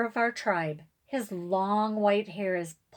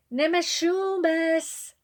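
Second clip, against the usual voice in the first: slow, louder, high-pitched call; frantic drawn-out call; 10 semitones higher, 5 dB louder, slower than usual. A narrator's reading. Girl calling for her great-grandfather, drawn-out frantic call. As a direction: stretch the name out as she calls it into the trees. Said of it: "I call frantically".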